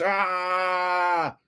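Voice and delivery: short high mock shriek of panic; hammed-up yelp; mock scream. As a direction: let out a cartoonish panicked "Ah!". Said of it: "for the people screaming".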